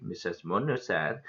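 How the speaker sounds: plain reading voice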